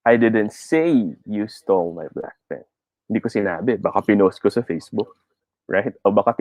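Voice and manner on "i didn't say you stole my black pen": slow line, "say" drawn out and raised; stress on SAY. Shown as a demonstration. Teacher demonstrating stress on the third word, "say". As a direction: lean long on the word "say". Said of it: "put it on the third word".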